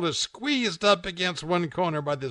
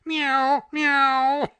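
much higher cartoon cat meows; cat meowing; 12 semitones higher, 6 dB louder than usual. Fluffy answering the owner, very high meows. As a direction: meow urgently like a cat.

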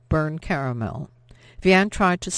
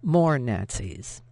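usual speaking voice

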